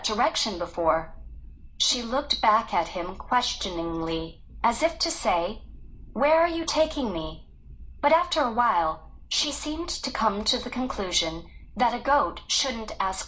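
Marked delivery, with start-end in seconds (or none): none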